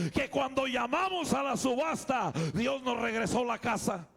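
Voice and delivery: loud, high, urgent preaching; preaching at full force. Acted. Pastor preaching his testimony to the congregation, loud and urgent. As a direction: preach it out to the church, rising.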